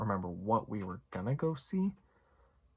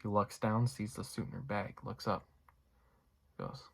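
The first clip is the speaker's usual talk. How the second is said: ordinary talk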